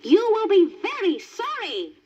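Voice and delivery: high witch voice; threatening witch. Acted. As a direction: menace him like a wicked witch. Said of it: "cried the witch".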